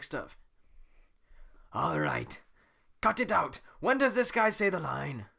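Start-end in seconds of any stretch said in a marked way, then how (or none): 1.7-5.3 loud, annoyed cut-off; fed-up snap. Acted. Shrek cutting off Donkey's song, loud and annoyed. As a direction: shut the singing down.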